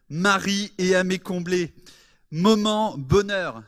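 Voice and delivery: slow, loud announcer reveal; announcer introduction. Real, not acted. Host building up a mystery guest for the church, announcer style. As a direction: draw out each title like a stage host.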